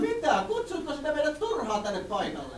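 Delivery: flustered villager woman. Acted. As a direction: squeal in a panic like a fussy villager. Scolding villager.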